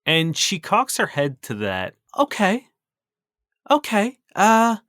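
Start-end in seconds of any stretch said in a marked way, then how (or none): 2.2-4.8 hesitant cook. Acted.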